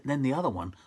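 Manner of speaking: ordinary talk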